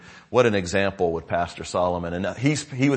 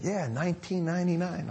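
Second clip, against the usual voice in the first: put-on child's answer; 10 semitones higher, 3 dB quieter than usual. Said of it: "one of them said".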